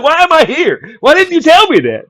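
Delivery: high, loud, indignant squeal; mock panic. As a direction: shriek it up high in mock outrage.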